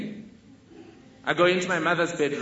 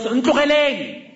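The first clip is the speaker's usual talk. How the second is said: shouted high and loud; yelling; 8 semitones higher, 7 dB louder than usual. Retold or imitated. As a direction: yell them off into the night. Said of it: "and yells".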